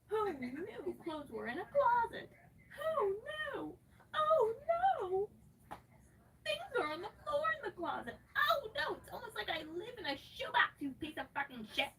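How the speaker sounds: high fake sobbing, sarcastic; hammed-up fake sob; mock crying. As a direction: wail it in fake despair.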